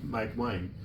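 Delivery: ordinary talk, nothing marked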